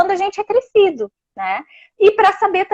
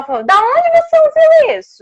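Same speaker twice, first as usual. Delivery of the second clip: much higher, sharp suspicious demand; accusing interrogation; 12 semitones higher, 4 dB louder, slower than usual. Shown as a demonstration. Parent demanding where the child heard it, harsh accusing tone. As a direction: interrogate the child as if catching them out. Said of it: "a tua entonação e a tua cara pode afastar".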